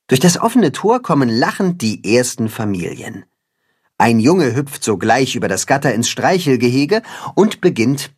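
usual speaking voice

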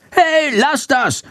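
very high, drawn-out squawky scolding; Schandor nagging. A narrator's reading. Schandor, attacked by two swallows, scolds them shrilly. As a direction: scold like a cross little bat. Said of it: "keift Schandor".

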